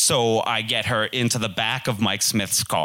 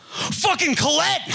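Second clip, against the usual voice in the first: sudden high yelp of dismay; panicked realisation. Retold or imitated. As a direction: shriek as it hits you that you forgot her. Sudden realisation he forgot her.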